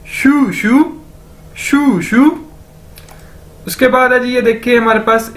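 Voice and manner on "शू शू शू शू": higher-pitched "शू शू", like shoe said twice; careful teacher's model of "शू शू"; demonstrated pronunciation. Shown as a demonstration. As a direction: drill "शू शू" high, pausing between takes. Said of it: "दो बार इकट्ठा बोला जाए".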